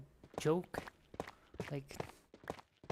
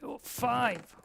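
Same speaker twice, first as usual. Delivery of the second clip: high, louder excited count; 8 semitones higher, 5 dB louder than usual. Real, not acted. Counting armadillo scutes, high excited cry. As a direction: pop up with delight.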